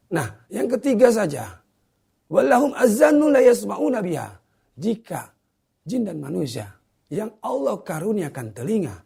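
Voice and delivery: high, ringing preacher's voice; forceful preaching. Real, not acted. Preacher giving viewers the third point, forceful preaching. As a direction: drive the point home, verse ringing out high.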